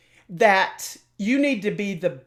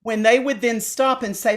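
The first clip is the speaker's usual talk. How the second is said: ordinary delivery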